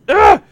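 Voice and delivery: high sharp scream; man down shout. Real, not acted. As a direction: yelp of alarm. Game danger scream.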